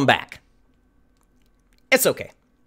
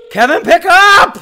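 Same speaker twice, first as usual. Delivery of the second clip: calling out; 8 semitones higher, 5 dB louder than usual. Real, not acted. Calling at phone.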